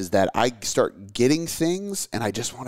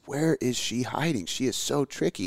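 lightly voiced little girl, higher pitch; imitating excited daughter; 5 semitones higher, 4 dB quieter than usual. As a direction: play a child hunting for something, pitched up. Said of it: "she was just going crazy".

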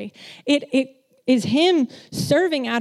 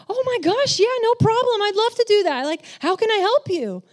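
much higher, bubbly eager yes; eager helper; 11 semitones higher, 4 dB louder than usual. Retold or imitated. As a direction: gush like you're thrilled to help.

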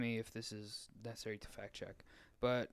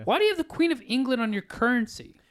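higher, louder incredulous question; 10 semitones higher, 7 dB louder than usual. Real, not acted. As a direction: demand it in disbelief.

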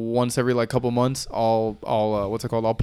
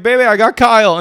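high, loud imitation of proud news; re-enacted gleeful homecoming shout; excited announcement; 9 semitones higher, 10 dB louder than usual. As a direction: call it out to a partner at home. Retold excited announcement at home.

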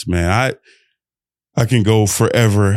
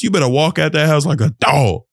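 ordinary delivery

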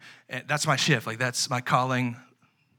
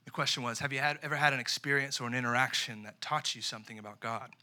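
usual speaking voice

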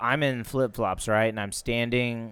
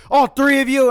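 much higher, louder accusing teacher line; 13 semitones higher, 10 dB louder than usual. Retold or imitated. Teacher accusing the boys in the stall, loud and high. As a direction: point at all three and scold.

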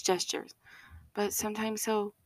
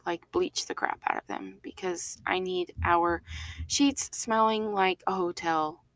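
ordinary talk

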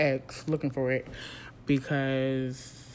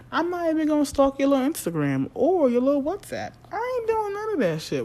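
defiant scoff; 11 semitones higher, 5 dB louder than usual. Real, not acted. Defiantly dismissing her ex.

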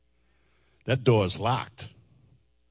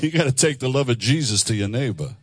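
ordinary talk

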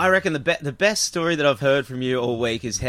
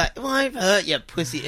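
high, dismissive taunt; 5 semitones higher than usual. Retold or imitated. Teen brushing off his mate's fear, high sneering taunt. Goading mate before firing.

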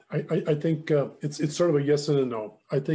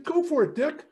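high, loud cheer of encouragement; retold enthusiastic urging; 13 semitones higher, 4 dB louder than usual. Mentor urges his friend to sell up, enthusiastic. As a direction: egg a friend on gleefully.

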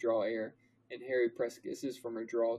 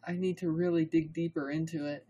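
higher female character voice; lightly pitched-up girl's line; Hermione; 6 semitones higher than usual. A narrator's reading. Narrator as Hermione teasing Harry, higher voice.

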